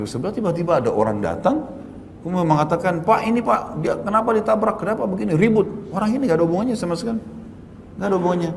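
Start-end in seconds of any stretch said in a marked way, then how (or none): 3.1-8.5 raised, fast, accusing bystander voice; a mild mock of an angry man blaming him. Angry stranger voiced in retold story.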